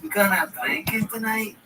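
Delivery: hammed-up Liverpool accent, drawn out; Scouse accent. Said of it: "Come on, the Scouse accent".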